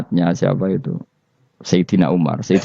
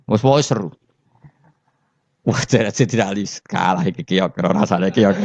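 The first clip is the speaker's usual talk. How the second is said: ordinary talk, nothing marked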